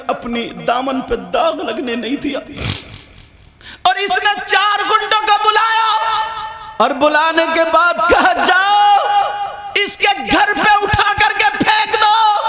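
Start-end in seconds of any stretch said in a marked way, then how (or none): 3.8-12.4 loud, raised storytelling sermon; impassioned religious storytelling; fervent preaching. A narrator's reading. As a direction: preach the story at full force to the crowd.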